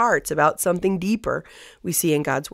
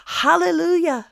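crying out; 10 semitones higher than usual. Acted. Great multitude like thunder praising God, crying out. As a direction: lift the voice high in acted proclamation. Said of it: "crying out".

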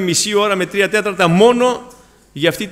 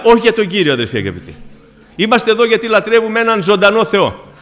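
preaching at full force; 3 semitones higher, 5 dB louder than usual. Acted. Preacher to the brothers about a living God, full force. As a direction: ring it out to the whole church.

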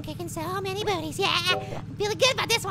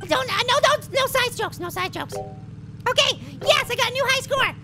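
normal talking voice

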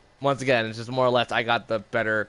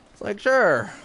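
sarcastic high voice; 9 semitones higher than usual. Acted.

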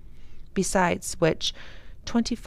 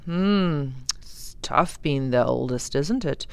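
father voice; 4 semitones lower than usual. A narrator's reading.

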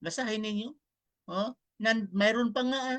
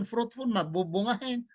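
usual speaking voice

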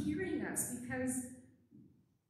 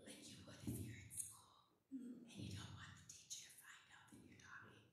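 breathy classroom whisper; 18 dB quieter than usual. Shown as a demonstration. Pastor whispering to children like hiding from the teacher. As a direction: whisper so the teacher won't catch you. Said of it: "say the words in a whisper".

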